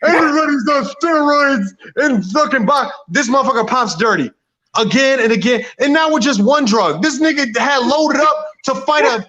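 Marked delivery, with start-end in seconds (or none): 0.0-2.9 slow, higher, put-on careless voice; mocking Jarrell Miller. Mocking a boxer who shrugs off steroids, slow and lazy. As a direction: drag it out like a shrugging cheat. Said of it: "he be like".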